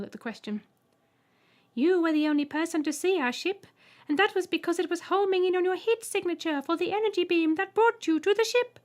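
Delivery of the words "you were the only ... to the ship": very high, loud piercing voice; Trembler. A narrator's reading. Alien greeting the human, piercing high voice. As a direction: squeak it out politely. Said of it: "the left Trembler elaborated, also reining in his suppressed laughter".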